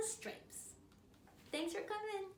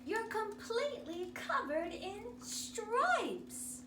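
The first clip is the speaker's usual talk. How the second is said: shocked; 7 semitones higher, 4 dB louder, slower than usual. Acted. Shocked mother in story.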